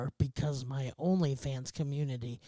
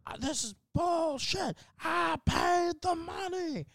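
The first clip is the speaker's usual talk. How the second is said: high, drawn-out mock wail; imitated yelling; 13 semitones higher, slower than usual. ER patient protesting to nurses, imitated slow high yell. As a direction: imitate an outraged patient whining at the top of the range. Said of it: "He's just yelling".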